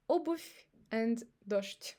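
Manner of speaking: обувь said obuf, дождь said doshd, slowly; обувь and дождь with voiceless ends; обувь ends in f, дождь ends in sht; final devoicing. Shown as a demonstration. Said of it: "before soft sign".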